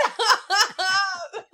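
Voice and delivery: high laughing cry of stop. Retold or imitated. Mom telling her son to stop saying it, high and laughing. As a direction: plead through laughter. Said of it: "And I'm like".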